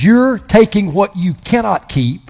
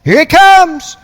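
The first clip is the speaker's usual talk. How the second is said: mocking churchgoer; 8 semitones higher, 3 dB louder than usual. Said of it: "A lot of you think".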